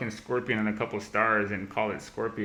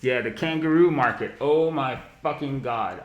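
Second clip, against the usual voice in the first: loud, higher mocking exclamation, slowing on the curse; mocking disbelief; 4 semitones higher, 6 dB louder than usual. Real, not acted. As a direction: scoff loudly at the silly term. Mocking a silly market term.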